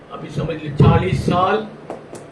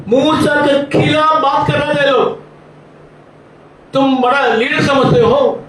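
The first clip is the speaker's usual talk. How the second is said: full-force preaching; 6 semitones higher than usual. Acted. Preacher rebukes those who spoke against Moses, forceful preaching. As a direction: shout the warning to the whole congregation.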